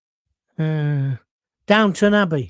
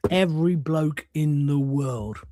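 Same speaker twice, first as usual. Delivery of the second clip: drawn out word by word; slow emphatic repeat; 3 semitones lower, slower than usual. Real, not acted. Host driving home that everyone has lived it, slow and emphatic. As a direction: spell it out slowly for weight.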